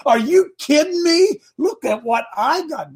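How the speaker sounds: high, incredulous mock taunt; hammed-up trash-talking challenger. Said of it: "He trash talked Goliath".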